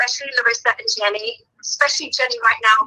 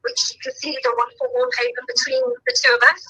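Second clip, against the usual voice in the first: normal talking voice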